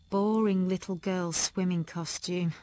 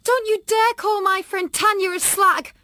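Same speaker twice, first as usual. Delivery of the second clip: loud, high furious yell; shout; 13 semitones higher, 10 dB louder than usual. A narrator's reading. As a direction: scream it from behind the door. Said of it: "I shouted".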